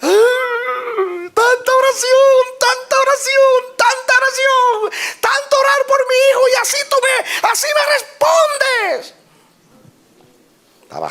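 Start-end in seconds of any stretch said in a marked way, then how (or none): 0.0-9.1 high, loud, slow cry of a grieving father; anguished outburst. Acted. Grieving father shouting his anger at God. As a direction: hurl it at God in grief. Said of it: "comenzó a juzgar a Dios".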